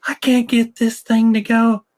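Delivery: high-pitched Southern drawl impression; wife's Southern drawl. Husband imitating wife fighting voice-to-text on her phone, Southern drawl. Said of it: "she has a drawl".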